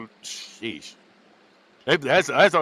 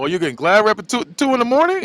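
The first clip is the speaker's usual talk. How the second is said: mocking tease; 8 semitones higher, 5 dB louder than usual. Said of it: "they're making fun of him again".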